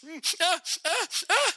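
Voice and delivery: high whimpering ahs; mock whimpered sobs; girl's cry. Shown as a demonstration. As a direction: whimper like a girl crying. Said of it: "you know, girls".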